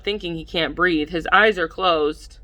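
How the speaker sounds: plain reading voice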